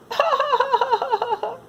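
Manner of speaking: high, giddy laugh; happy laugh. Acted. Edue laughing happily as he runs from the dog. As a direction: laugh while running off. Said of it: "laughed Edue".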